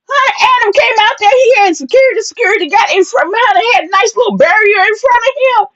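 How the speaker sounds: high, fast excited retelling; breathless excited gossip; excited gushing. Real, not acted. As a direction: rush it out, thrilled.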